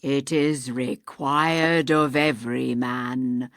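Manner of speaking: low, slow, eerie ghostly voice; hollow ghost. Acted. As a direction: intone like a dead man's spirit.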